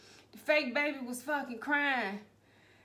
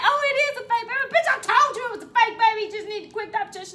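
high, loud mocking voice; mocking imitation; 10 semitones higher, 9 dB louder than usual. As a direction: mimic her sneeringly. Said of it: "she was like".

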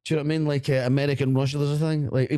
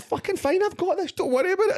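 very high-pitched mock cockiness; retold drunken bravado. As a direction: wave the pals off, voice way up high. Drunk bravado retold to worried pals.

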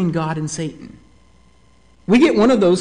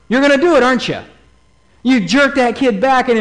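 normal talking voice

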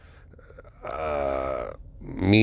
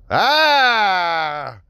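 laughing; 9 semitones higher than usual. Real, not acted. Laughs at own callback.

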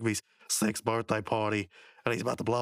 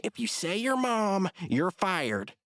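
slow, raised mock warning; Benson; 9 semitones higher, slower than usual. Boss warning a prankster, slow high mock threat. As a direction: threaten slowly as a cartoon boss. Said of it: "then Benson says".

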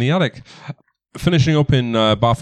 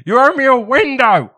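shouting mayor; 12 semitones higher, 4 dB louder than usual. Acted. Game master as mayor yelling after the cart, high acted shout. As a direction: bellow after them in a put-on high voice.

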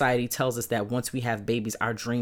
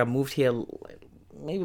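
ordinary talk

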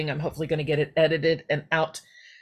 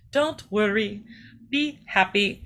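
light imitation of a mother's Jamaican accent, a bit higher; 4 semitones higher, slower than usual. Mother singing [name] to her busy daughter, lightly voiced Jamaican accent. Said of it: "try to do this little Jamaican accent".

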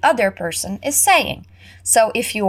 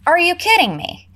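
higher, quick, indignant question; upset disbelief; 9 semitones higher, faster than usual. Acted. Jane, still upset, snapping at John in disbelief. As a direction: fire it back in mock outrage. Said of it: "still very upset".

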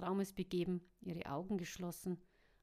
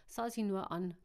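plain reading voice

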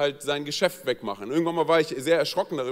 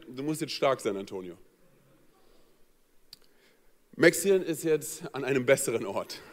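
solemn comfort; 3 semitones lower than usual. Retold or imitated. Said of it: "Und sie sagte zu mir".

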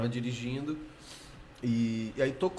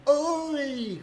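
a much higher, bright "Oi!"; eager phone hello; 13 semitones higher than usual. Retold or imitated. Young actor picking up a call from Globo, eager. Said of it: "aí você fala logo".

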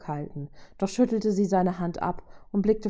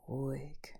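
low-key, very soft murmur; 4 semitones lower, 12 dB quieter than usual. A narrator's reading. Robot restraining his angry ally, soft murmur. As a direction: hush it right down. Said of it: "raunte er".

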